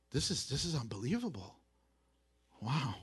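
soft, low, quick murmur of disbelief; quiet pondering wonder. Acted. Young Mary considering the angel's greeting, softly awestruck. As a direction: think it aloud, hushed and amazed. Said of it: "She's, like, considering".

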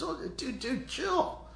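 high, casual surfer-like voice; laid-back prophet. Acted. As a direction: tell him to relax, half-joking. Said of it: "So Elisha says".

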